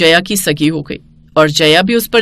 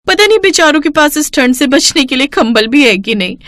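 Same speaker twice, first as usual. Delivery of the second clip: higher, bright showing-off voice; boastful young bride; 4 semitones higher than usual. Acted. Bride shows off her father's gifts to mother-in-law, higher and boastful.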